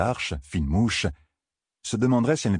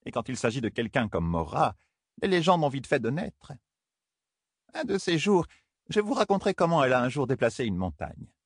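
higher, light elder woman voice; wise woman voice; 8 semitones higher than usual. Acted. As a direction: tell it like a knowing elder. Amis tells of Mora.

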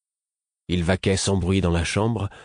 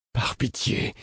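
pleading, half-whispered; begging; 5 semitones higher, 4 dB quieter, slower than usual. A narrator's reading. Fitz begging the fool, pleading whisper. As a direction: beg for mercy. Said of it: "le suppliai-je".

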